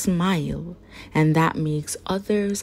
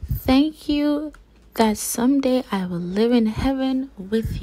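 put-on heartfelt child's prayer; 4 semitones higher than usual. Children's prayer read aloud.

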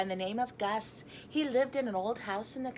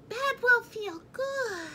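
high slow sleepy mouse; squeaky mouse voice; 14 semitones higher, slower than usual. A narrator's reading. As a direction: sigh it like a tired mouse. Mouse character voice.

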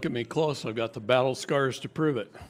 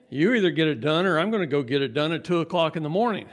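louder, higher retold demand; impatient demand; 5 semitones higher, 8 dB louder, faster than usual. Man retelling his call to a colleague, impatient demand. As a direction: push it out loudly, fed up.

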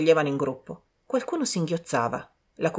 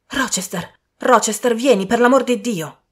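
calling out louder and louder for Rochester; desperate call; 5 semitones higher, 7 dB louder than usual. A narrator's reading. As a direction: beg him to come, frightened. Cry for help through ceiling. (richiamo disperato, sempre più forte)

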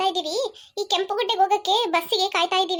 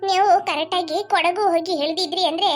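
low-pitched village granny chiding; scolding old woman; 4 semitones lower than usual. Acted. Granny blaming the phone map for the mistake, scolding old woman's voice. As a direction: chide gently in an old woman's lower voice.

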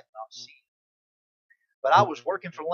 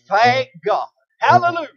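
loud, high hallelujah; joyful praise shout; 8 semitones higher, 13 dB louder than usual. Real, not acted. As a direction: burst out in praise. Preacher's praise outburst.

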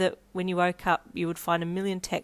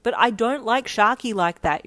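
louder, higher exasperated protest; slightly exasperated; 4 semitones higher, 7 dB louder than usual. Acted. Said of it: "slightly exasperated now".

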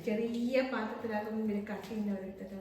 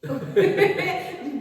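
loud, higher burst of laughter; 7 semitones higher, 11 dB louder than usual. Real, not acted. Teasing over the phone demand. (ഉറക്കെയുള്ള പൊട്ടിച്ചിരി)

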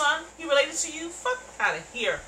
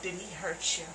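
mocking quote; 5 semitones lower, 7 dB quieter than usual. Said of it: "Talking about".

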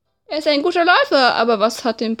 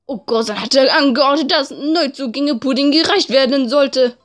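usual speaking voice

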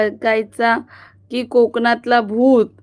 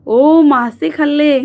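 excited surprise; 4 semitones higher, 6 dB louder, slower than usual. Real, not acted. Reacts to a viewer eating fish.

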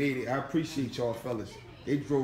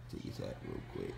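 whisper; 13 dB quieter than usual. Real, not acted. Aside while cousin sleeps.

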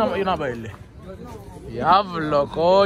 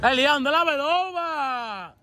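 calling out; 6 semitones higher, much slower than usual. Real, not acted. Calling out across the store.

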